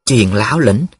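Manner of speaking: ordinary delivery, nothing marked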